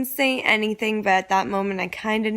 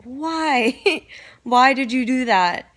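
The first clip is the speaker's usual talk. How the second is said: disbelieving question broken by a laugh; laughing disbelief; 4 semitones higher, slower than usual. Retold or imitated. [name] retelling her confrontation with Lele, laughing in disbelief. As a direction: laugh through the shock. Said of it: "I'm like".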